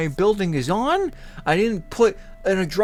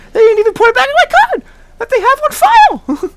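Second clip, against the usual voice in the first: high, loud outburst of real outrage; outraged yell; 9 dB louder, faster than usual. Real, not acted. Customer ranting about Uber keeping the refund, outraged yell. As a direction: yell it in furious disbelief.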